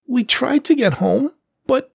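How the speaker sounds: very high, small pleading voice; scared kitten. A narrator's reading. Serval cub explains to the panther, timid and pleading. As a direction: plead like a frightened child.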